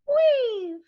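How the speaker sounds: a playful birdlike voice; bird voice. Shown as a demonstration. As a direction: chirp it like a little bird. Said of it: "bird almost like voice".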